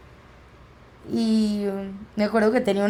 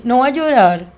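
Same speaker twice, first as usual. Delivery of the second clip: louder, insistent command; firm warning; 3 semitones higher, 9 dB louder than usual. Retold or imitated. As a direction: tell her firmly she won't cry. Warning mom as she walks in.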